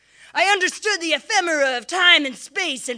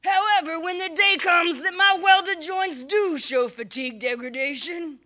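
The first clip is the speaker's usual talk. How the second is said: ordinary talk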